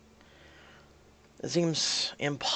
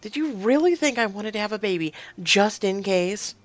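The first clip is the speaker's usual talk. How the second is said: louder, higher angry challenge; mad; 5 semitones higher, 6 dB louder than usual. Acted. Woman confronts partner over a backup baby, angry. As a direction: throw the question at him indignantly. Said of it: "didn't think you would be so mad".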